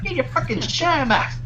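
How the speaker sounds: high, mocking gangster sneer; Pesci impression. Shown as a demonstration. Friend answers with a Pesci taunt. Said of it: "That was someone else".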